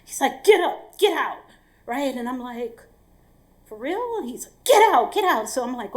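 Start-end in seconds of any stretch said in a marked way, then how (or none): 0.4-1.4 loud, high-pitched command to leave; angry order. Retold or imitated. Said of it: "he's mad". 3.6-4.2 incredulous question. Retold or imitated. Acted disbelief at being thrown out. 4.6-5.5 loud, high shout, easing on the repeat; angry yell. Retold or imitated. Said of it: "he's mad".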